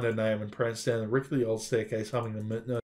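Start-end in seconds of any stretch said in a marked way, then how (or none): none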